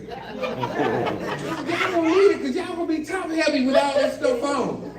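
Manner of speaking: drawn-out laughing yell; mock scolding. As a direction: holler it at the kids, grinning. Punchline shouted at grandkids.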